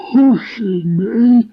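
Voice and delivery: incredulous donkey, stretching out the me; surprised. A narrator's reading. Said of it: "said Eeyore in surprise".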